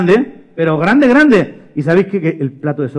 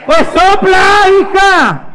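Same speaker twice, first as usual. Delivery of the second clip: very high, loud yell; exasperated mother; 14 semitones higher, 6 dB louder, much slower than usual. Acted. Mother answering screaming María, exasperated high yell. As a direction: scream back like a fed-up mother. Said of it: "qué le dijo la mamá".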